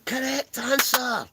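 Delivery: high, slow mock quiz-host call; game show host. Host calling out the winning answer in a game-show voice.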